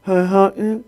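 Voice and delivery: imitating the clerk's garbled grunts; mock counter-clerk mumble; unintelligible mumble. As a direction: garble it past understanding. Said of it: "played the same little game with me".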